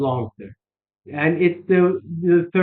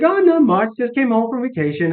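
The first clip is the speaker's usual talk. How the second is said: higher, chirpy receptionist voice; chatty receptionist; 6 semitones higher than usual. Retold or imitated. Receptionist chatting to a caller she thinks she knows, chirpy and high. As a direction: mimic a bubbly receptionist. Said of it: "She's like".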